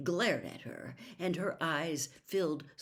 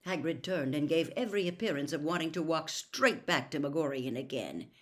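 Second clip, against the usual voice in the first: ordinary delivery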